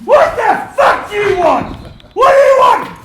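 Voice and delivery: roaring menace, slow and enraged; furious shout. Retold or imitated. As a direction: yell it at a man with a gun to his neck. Retold threat with shotgun.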